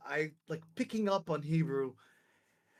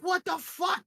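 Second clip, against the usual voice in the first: high, startled what the fuck; shocked outburst; 14 semitones higher than usual. Retold or imitated. Reacting to the soldiers' apology, shocked outburst. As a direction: blurt it out in disbelief.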